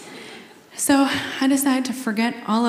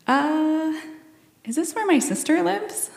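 retold confusion; 7 semitones higher than usual. Walks in on unknown man.

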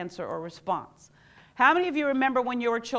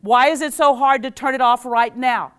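exasperated loud question; 9 dB louder than usual. Shown as a demonstration.

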